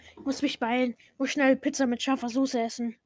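usual speaking voice

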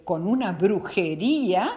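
usual speaking voice

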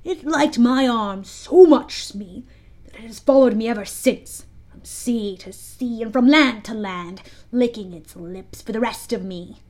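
usual speaking voice

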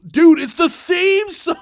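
high, loud, slow insisting; excited exclaim. Retold or imitated. Brother telling the kid the theme song is playing, high loud exclaim. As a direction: stretch out dude in disbelief. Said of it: "I'm like".

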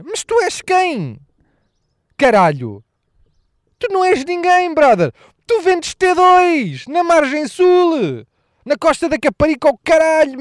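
high, slow, exasperated rant; mock outraged rant. Comedian ranting at the realtor, high and slow.

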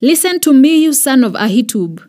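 loud, high furious shout. A narrator's reading. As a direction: yell it at the priest in rage. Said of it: "Saul shouted at him".

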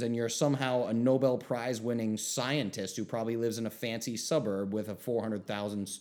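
ordinary talk

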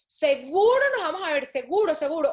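hammed-up insistence we'll meet; mock eager promise. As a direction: overeager fake promise. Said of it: "es como".

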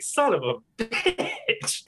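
high, slow curse, breaking into a laugh; drawn-out son of a bitch dissolving into laughter. Retold or imitated. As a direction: caught out and cracking up mid-swear. Caught, retelling own reaction.

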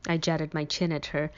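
ordinary delivery

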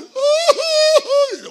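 long, very high, loud mock wail; llanto exagerado imitado. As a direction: wail like a melodramatic mourner, hammed up. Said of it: "dice la Biblia que empezaron a llorar". (llanto fingido y exagerado)

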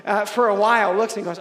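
ordinary talk, nothing marked

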